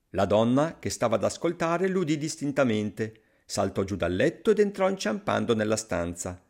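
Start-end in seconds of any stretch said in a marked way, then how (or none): none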